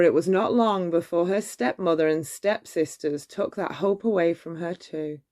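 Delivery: usual speaking voice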